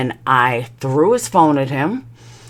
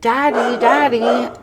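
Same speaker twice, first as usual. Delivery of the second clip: put-on high child's call; Dayton; 9 semitones higher, slower than usual. Child calling for daddy at the car, high-pitched. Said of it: "Dayton's like".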